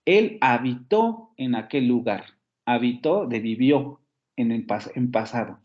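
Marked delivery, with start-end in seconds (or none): 0.4-1.3 «habitó» con el golpe en TÓ; acento en la última sílaba. Shown as a demonstration. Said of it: "la posición del acento". 2.7-3.3 «Habitó» pronunciado ha-bi-TÓ; acentúa la TÓ de «Habitó»; acento en la última sílaba. Shown as a demonstration. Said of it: "la posición del acento".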